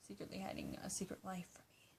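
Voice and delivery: secretly hiding a secret life, whispered fast. Real, not acted. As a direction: lean in and whisper the tease. Teasing conspiratorial aside.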